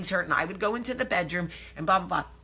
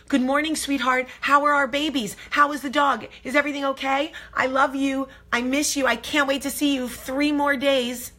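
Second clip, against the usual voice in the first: eager gushing; 5 semitones higher than usual. Retold or imitated. Wife texting husband from the road, eagerly gushing. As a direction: pile on the affection, pitched up.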